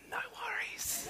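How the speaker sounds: tiny high whispered reply; strained whisper. Acted. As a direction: whisper it as if forcing yourself to stay easygoing. Stifled reaction to wrong order.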